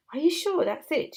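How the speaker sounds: ordinary delivery, nothing marked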